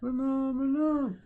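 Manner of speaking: Phenomenon, high and slowly drawn out; mock announcer. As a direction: announce Phenomenon like a hype man.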